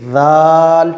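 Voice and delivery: दाल with the tongue on the front teeth. Shown as a demonstration. Teacher shows दाल to students, tongue on teeth. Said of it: "आगे के दांतों से लगेगी".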